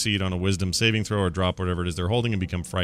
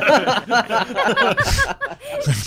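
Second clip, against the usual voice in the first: high burst of giggling laughter; gleeful cackle; laughing; 7 dB louder than usual. Real, not acted. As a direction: laugh out loud with glee. Player laughs at own plan.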